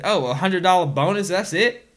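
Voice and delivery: lightly mimicked scornful employee; mock disdain. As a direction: turn your nose up at it. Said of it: "turn their nose up and say".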